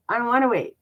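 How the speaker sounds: high, loud bratty whine; pouting brat refusing to wait; spoiled brat whine. Retold or imitated. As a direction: whine it like a spoiled little kid. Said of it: "like a spoiled little brat".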